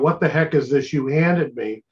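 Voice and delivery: ordinary delivery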